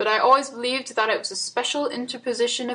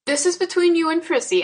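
higher, firm interruption; struck in; 8 semitones higher, 4 dB louder than usual. A narrator's reading. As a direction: cut in decisively. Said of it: "I struck in".

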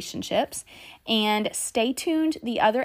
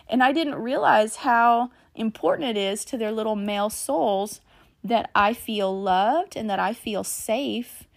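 ordinary delivery

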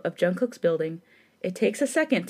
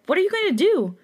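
alarmed; 10 semitones higher, 4 dB louder than usual. Acted. Heroine panicking.

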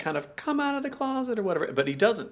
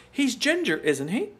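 imitated high-pitched question; Miss Brahms; 8 semitones higher than usual. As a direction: jump high on ginger, then lift the tag. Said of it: "what Miss Brahms says".

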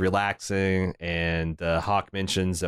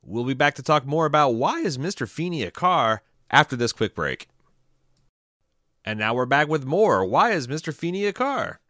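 slightly higher, bright show-host break read; light podcast announcer read; mock radio announcer; 4 semitones higher than usual.

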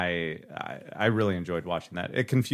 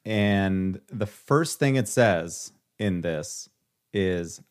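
ordinary talk

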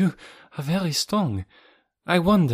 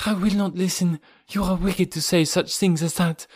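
higher, louder, defiant woman's voice; defiant Olga; 7 semitones higher, 3 dB louder than usual. Acted. Olga warns Rokoff she will tell Raoul, defiant. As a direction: rise to a threat at the end.

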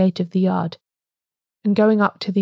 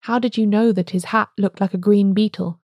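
plain reading voice

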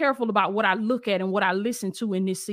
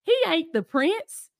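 put-on girl's voice; 7 semitones higher than usual. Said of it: "she said".

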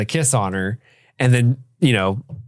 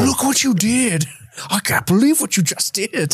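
high, drawn-out mock dismay; Henry; 9 semitones higher than usual. Father to son after the shooting, high mock reproach. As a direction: imitate an appalled father, pitched way up. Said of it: "Henry's just like".

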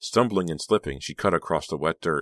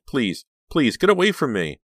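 high, loud, desperate begging; terrified screamed plea; 8 semitones higher, 5 dB louder than usual. A narrator's reading. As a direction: plead in panic to be left alone. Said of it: "she screamed".

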